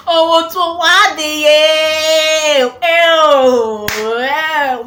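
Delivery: loud, long drawn-out wail; mock wailing lament. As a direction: wail it slowly in exaggerated despair. Mock lament over crushed hopes.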